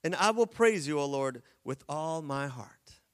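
loud opening praise line; scripture reading. Shown as a demonstration. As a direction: proclaim it to the church. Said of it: "He starts with this".

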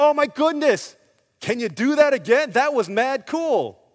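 loud, high, hammed-up delight; mock thrilled. Jailer wanting the earthquake repeated, mock thrilled. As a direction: play a goofy fan blown away.

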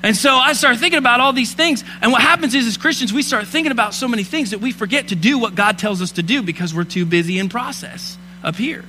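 impassioned Christmas sermon; preaching. Acted. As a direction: preach it with fire to the congregation. Preaching at full force.

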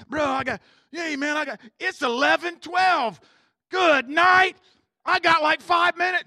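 loud whiny excuse, shouting; hammed-up loud complaining excuse; mock protest. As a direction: brush it off loudly like a tired guy. Self talking out of God's nudge.

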